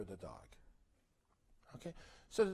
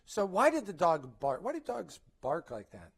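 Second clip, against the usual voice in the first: usual speaking voice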